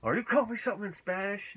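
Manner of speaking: high-pitched imitation of an offended man; upset mock outburst. Builder who thinks Manuel insulted him, mock upset. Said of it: "gets really upset right away".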